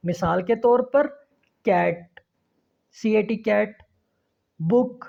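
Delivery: ordinary delivery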